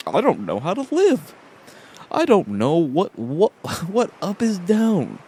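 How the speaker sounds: high, loud, bewildered gadget voice; clueless phone. Pretend. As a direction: play a helpless phone in comic confusion. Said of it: "my phone's like".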